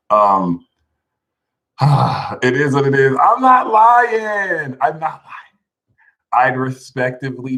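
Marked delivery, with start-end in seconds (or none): 3.2-4.8 high, slow, louder; laughing insistence. Real, not acted.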